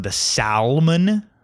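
salmon with the L sounded, SAL-mun, rising at the end; mispronounced. Retold or imitated. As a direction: say salmon wrongly, pronouncing the silent L. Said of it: "pronounce things wrong".

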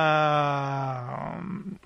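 wild laugh-scream. Acted. Long wild laugh-cry.

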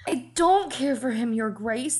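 high, urgent pleading; desperate. Acted. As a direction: beg desperately, words tumbling out. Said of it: "the words tumbled out desperately".

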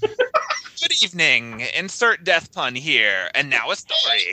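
high, very slow ghoulish host voice; Crypt Keeper. Retold or imitated. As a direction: stretch it out like a creepy horror host. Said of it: "he's pretty much the Crypt Keeper".

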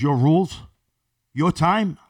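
loud mocking challenge before a punch; defiant challenge. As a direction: throw his words back at him hard. Retold confrontation before slap.

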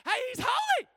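high, loud shout of an overexcited angel; high-pitched acted yell; excited scream. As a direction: scream it to the one across from you, bursting with excitement. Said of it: "one angel is screaming to the other".

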